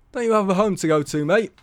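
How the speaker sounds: the steward tells Tony to leave, impatient; gruff steward. A narrator's reading. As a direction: hurry him out. Steward addresses lingering Tony.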